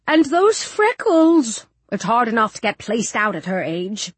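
drawn-out, weary disapproval; sighing matron. A narrator's reading. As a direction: sigh it like a tired matron. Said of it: "then sighs".

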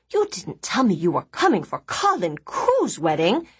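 ordinary delivery, nothing marked

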